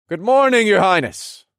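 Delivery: high, ringing formal hail; loud greeting. Acted. Approaching the throne to greet a king, loud high voice. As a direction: project it loud and high across a room. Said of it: "I greeted in a loud voice".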